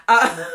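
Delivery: high burst of laughter after a loud "uh"; real laugh. Real, not acted. Host amused by her own inside joke, laughing. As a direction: crack up loudly.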